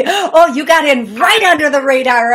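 loud, high exclamation; real surprise. Real, not acted. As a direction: burst out in surprise at a late arrival. Surprised caller got through.

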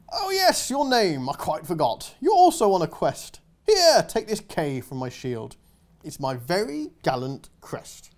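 kindly pompous knight; posh knight. Acted. Knight giving the girl a letter, pompous.

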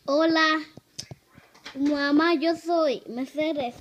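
loud vlog greeting. Real, not acted. Child greets viewers loudly.

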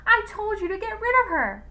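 high, loud furious scolding; angry shout. A narrator's reading. Wife scolds husband for letting the visitor in, angry shout. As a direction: yell the rebuke high and hard. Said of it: "shouted Jane".

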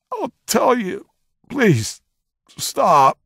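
panicked prisoner giving in; breaking down in terror. Acted. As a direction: crack and beg him to stop. Prisoner breaking under torture.

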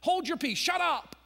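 high, loud scolding; hushing him. Acted. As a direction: shut him up sharply. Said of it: "many of those people with Jesus said".

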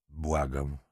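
soft, sunken begging; frightened plea. A narrator's reading. Marquise begs the lieutenant to let go, frightened. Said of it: "Krzyknęła przestraszona".

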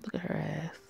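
breathless whisper, almost no voice; a sly, leering whisper. Acted. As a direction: whisper it so only your friend hears. Leering aside about a woman.

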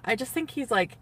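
ordinary talk, nothing marked

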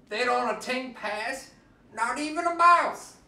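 usual speaking voice

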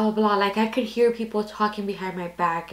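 usual speaking voice

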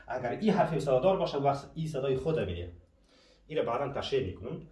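ordinary talk, nothing marked

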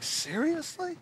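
soft, high rising question; quiet disbelief. Retold or imitated. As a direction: ask it under your voice, stunned. Acting stunned disbelief, first take.

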